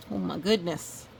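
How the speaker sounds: plain reading voice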